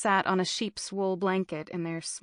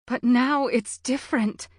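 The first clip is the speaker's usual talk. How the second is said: higher, louder anxious woman; higher-pitched protesting mother; worried mother; 6 semitones higher, 5 dB louder than usual. A narrator's reading. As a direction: voice a mother raising her fears. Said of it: "his mother said".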